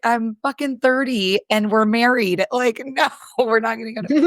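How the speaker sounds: you can't be serious, Brian; exasperated retort. Retold or imitated.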